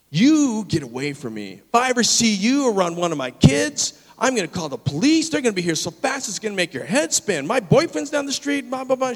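heated, high-pitched mock tirade; angry tirade. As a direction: imitate a woman tearing into someone. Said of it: "she launched in to this tirade".

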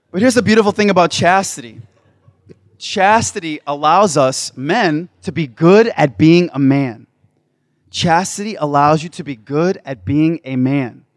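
loud, high sermon voice; preaching at full force. Real, not acted. Preacher exhorting the men, loud sermon voice.

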